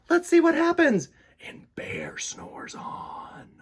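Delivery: hushed whisper before the story. Real, not acted. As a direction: whisper it like a secret.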